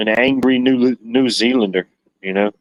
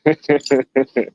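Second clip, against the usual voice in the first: short real chuckle; brief run of light laughter; chuckling; 2 semitones higher than usual. Real, not acted. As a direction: chuckle to yourself. Amused at own mistake.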